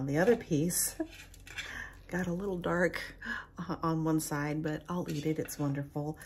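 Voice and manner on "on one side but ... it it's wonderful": ordinary talk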